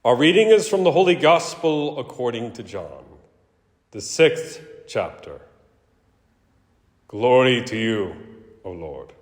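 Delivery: solemn liturgical reading, Jesus' words lifted; Gospel reading. Acted. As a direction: proclaim the Gospel to the congregation. Priest proclaims the Gospel reading.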